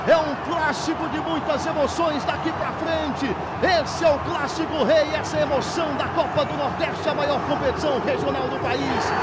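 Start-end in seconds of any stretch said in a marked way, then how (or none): none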